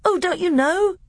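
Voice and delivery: put-on very high, eager little boy; bright-eyed boy piping up; Caspian. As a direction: innocent child surprised his uncle doesn't know. But he babbled on.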